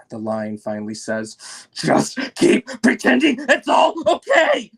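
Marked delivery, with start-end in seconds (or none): 1.7-4.7 high, loud shrieking voice of an angry mother. Acted. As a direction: shriek it through the closed door.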